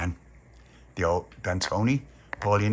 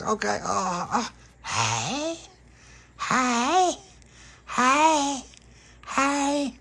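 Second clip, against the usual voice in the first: high, crying hey calls; wailing vampire calls; much slower than usual. Acted. Dolores joining the hunt, high wailing calls. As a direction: wail each hey up high.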